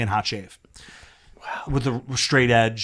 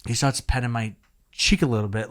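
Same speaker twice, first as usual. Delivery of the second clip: ordinary talk, nothing marked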